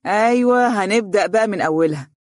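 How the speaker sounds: playfully stirring up trouble; mischievous. A narrator's reading. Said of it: "صفق عمرو بمشاغبة وقال".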